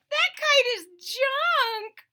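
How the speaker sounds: high, slow, loud sneer; mock it like a smug little bird; robins' voice. Robins mocking Toad's crashed kite, high slow sneer. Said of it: "said the robins".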